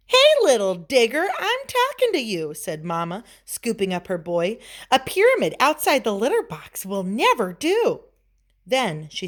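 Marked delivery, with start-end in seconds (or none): 0.1-2.5 high-pitched, insistent mother; scolding mama. A narrator's reading. Mama scolds her boy. 4.9-8.0 lightly voiced disapproving mother; scolding mama. A narrator's reading. Mama scolds her boy.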